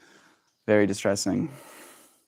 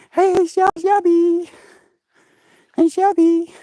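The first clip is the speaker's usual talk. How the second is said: much higher cutesy cooing; 7 dB louder, slower than usual. Real, not acted. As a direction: coo at the cat in a tiny high voice. Calling the cat.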